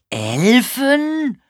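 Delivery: high, loud, incredulous echo; hard-of-hearing old man querying a misheard word; baffled old man. A narrator's reading. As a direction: swoop up loudly in disbelief. Deaf old man mishears the question.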